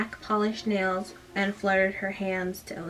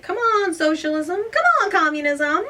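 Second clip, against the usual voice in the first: mock exclamation; 9 semitones higher, 6 dB louder than usual. A narrator's reading. Reader mock-performs outburst in story.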